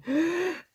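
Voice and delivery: sharp gasping hiccup; a sudden hiccup gasp. Real, not acted. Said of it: "désolée, j'ai hoqué".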